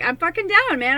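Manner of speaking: high, loud pumped-up declaration. Retold or imitated. Declaring she's ready for the fight, high loud shout. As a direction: shout it like you're ready for war. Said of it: "now I'm just like".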